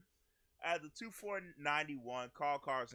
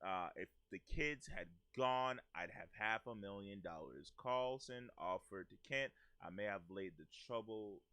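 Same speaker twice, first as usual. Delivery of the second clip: reading the husband's words; 8 semitones lower, 4 dB quieter than usual. Retold or imitated. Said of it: "Carson replied".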